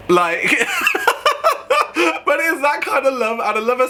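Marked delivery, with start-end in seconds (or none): 0.5-2.2 a very high squealing laugh; shrieking laugh. Real, not acted. Laughing at Vivienne's joke.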